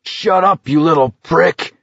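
high, strained shout; angry yell. A narrator's reading. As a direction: yell him into silence. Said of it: "Abraham yelled".

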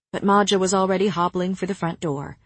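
usual speaking voice

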